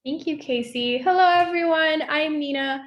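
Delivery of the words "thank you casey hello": ordinary talk, nothing marked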